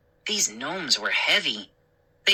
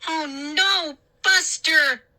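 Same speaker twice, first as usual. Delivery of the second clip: high alarmed scream; 12 semitones higher, slower than usual. A narrator's reading.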